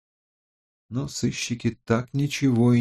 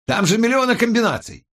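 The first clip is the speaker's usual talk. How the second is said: very high-pitched exasperated protest; flaring up; 13 semitones higher, 4 dB louder than usual. A narrator's reading. Colleague objects to trying again, exasperated. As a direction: protest hotly, voice shooting up. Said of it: "взвился Борис".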